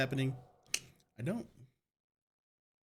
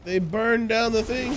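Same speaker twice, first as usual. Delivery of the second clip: shocked; 11 semitones higher, 8 dB louder than usual. Real, not acted. Player sees burned town.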